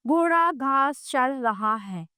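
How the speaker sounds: घोड़ा घास चर रहा है said slowly, word by word; slow example sentence. Shown as a demonstration. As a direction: spell the example out slowly for pupils. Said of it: "उदाहरण के लिए". (धीरे-धीरे साफ़ बोलकर)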